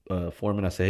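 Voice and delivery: ordinary delivery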